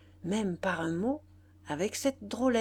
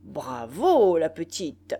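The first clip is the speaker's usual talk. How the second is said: bystander's cheer; 3 semitones lower, 7 dB louder, slower than usual. A narrator's reading. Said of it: "Un monsieur dit".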